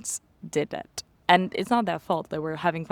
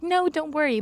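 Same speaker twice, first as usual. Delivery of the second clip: higher, easy reassurance; reassuring; 10 semitones higher than usual. Retold or imitated. Worker insisting she keep his umbrella, higher. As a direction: brush off her worry, higher.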